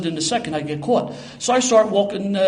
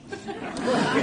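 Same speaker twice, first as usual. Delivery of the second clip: breathy high giggle; short airy laugh; light laugh; 5 dB quieter than usual. Real, not acted. Laughs at own plan.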